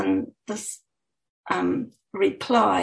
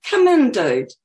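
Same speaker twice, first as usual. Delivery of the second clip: laid-back; 3 semitones higher, 7 dB louder than usual. Acted. Young man welcomes visitor.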